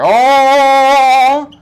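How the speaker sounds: long, loud, high held "oh"; heavenly choir "oh". Pretend. Showing viewers the deal of a lifetime, mock heavenly "oh". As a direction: sound a mock angelic note. Angelic choir sound for the halo.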